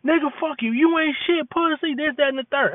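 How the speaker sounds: high mock argument between parents, no shouting; imitating parents cursing. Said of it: "All you heard was".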